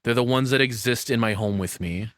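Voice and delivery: ordinary delivery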